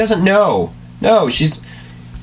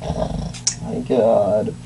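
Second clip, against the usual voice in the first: dismayed groan; 7 semitones lower, 6 dB quieter, much slower than usual. Real, not acted. Dismayed reaction.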